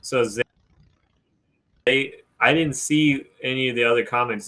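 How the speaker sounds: usual speaking voice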